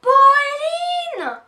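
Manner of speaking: screamed high and loud, drawn out; storming out to scream at her sister; furious yell. Acted. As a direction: shriek her sister's name in rage. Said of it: "et hurle".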